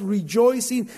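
ordinary delivery

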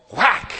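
loud, high mock "Whack!" sound; imitated thud. The preacher acts out slipping and falling with a loud imitated whack. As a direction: smack the word out like a body hitting the ground. Acting out a fall.

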